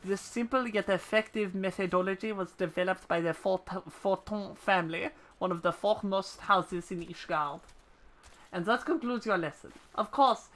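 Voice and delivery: laboured put-on French accent; bad French accent. Ishgardian instructor giving a chocobo lesson, bad French accent. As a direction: read it out in a hammy French accent. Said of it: "I'm so bad at this fucking accent".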